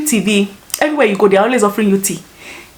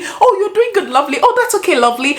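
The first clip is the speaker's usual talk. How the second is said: mock-soothing pet names, very high; imitating over-familiar stranger; 11 semitones higher than usual. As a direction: coo it in a high put-on voice. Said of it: "someone would just come and go".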